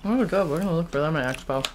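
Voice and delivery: nibbling bar while speaking; talking while eating. Real, not acted. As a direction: mouth partly full. Seen 1: Woman holds bar at lips, nibbling, while words are said; speaker uncertain.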